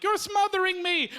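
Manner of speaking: high-pitched mock outburst; complaining lobster. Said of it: "the lobster is like".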